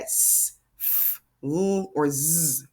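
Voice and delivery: "s", "f", "l", "z" each held out; letter sounds. Shown as a demonstration. Teacher recapping rule endings, letter sounds held out. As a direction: say "s", "f", "l", "z" as long sounds, not letter names. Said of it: "have to have that".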